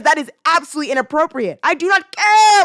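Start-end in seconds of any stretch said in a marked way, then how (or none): 1.6-2.6 louder, higher, slow and firm; emphatic outburst. Real, not acted. Ranting at the parents, emphatic and loud. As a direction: slam each word down. Ranting about kids contacting her.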